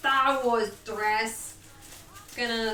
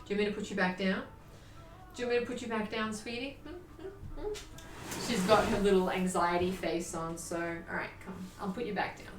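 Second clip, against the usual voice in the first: hushed, soft reassurance; calm her down gently; soothing the dog; 2 semitones lower, 7 dB quieter than usual. Real, not acted. Owner gently soothes her anxious dog, hushed.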